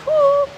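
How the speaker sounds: high, loud breath-out after the set; loud whew of relief. Real, not acted. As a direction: blow out the effort. Exhale after compound lift.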